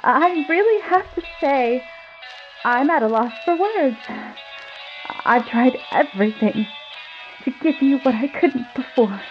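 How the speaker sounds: high, wavering wife voice; trembling voice. Acted. Wife talking to Michael from the kitchen, trembling high voice. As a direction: quiver through it, barely holding together. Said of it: "in a trembling voice".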